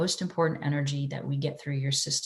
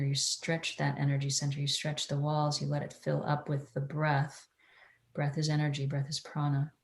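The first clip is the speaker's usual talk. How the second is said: soft, hushed meditation guide; airy breathy mystical healer; 9 dB quieter than usual. Acted. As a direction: guide them inward, softer.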